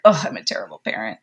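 low, fast, self-blaming rush; panicked thought. Retold or imitated. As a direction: blurt it out low and quick in dismay. Parent's panicked inner thought.

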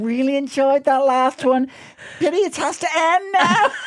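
very high, drawn-out fake-sweet wail; mock gushing. Imitating someone's backhanded flattery, high slow mock-tearful squeal. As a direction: squeal slow compliments with a hidden dig. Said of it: "when somebody's kind of goes on about it".

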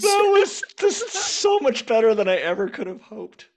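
delighted, high laughing voice. Real, not acted. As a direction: still laughing through the words. Host still laughing, delighted.

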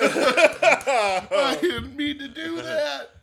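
high, loud, drawn-out protest through laughter; laughing wail. Real, not acted. Guest excusing a dropped wing to friends, laughing wail. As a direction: squeal it out slowly while cracking up.

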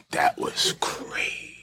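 breathy whispered repeat; whispering. Shown as a demonstration. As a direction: whisper it slowly.

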